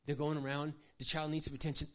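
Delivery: ordinary delivery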